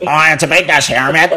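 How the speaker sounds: put-on quick Belfast accent; a light Northern Irish Belfast voice. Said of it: "if I had it done a Belfast accent".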